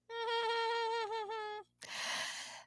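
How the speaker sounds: long, high giggling run; high laugh. Real, not acted. Host laughs about explaining dating to daughter, high laugh. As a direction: giggle high at your own joke.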